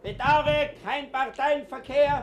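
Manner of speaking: loud and high, slowly spelled out; shouting an office closing notice at the warden; mock-polite refusal shout. Refusing entry through the door.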